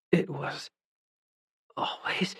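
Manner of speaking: faint, strained whisper; each word costs her strength; weak whisper. Acted. Dying London whispers to Dawson, faint. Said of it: "Every word seemed to take all her strength".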